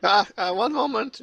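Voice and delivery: high, stammering stall; flustered guard. Acted. Guard stalling the arbitrator, flustered and high.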